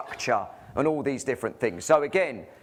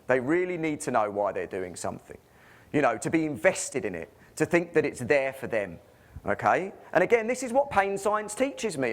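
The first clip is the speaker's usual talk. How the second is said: ordinary talk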